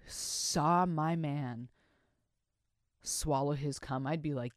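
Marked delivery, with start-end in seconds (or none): 0.1-1.7 very slow saw my man. Real, not acted. Host building suspense, very slowly. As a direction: stretch each word. Very slowed.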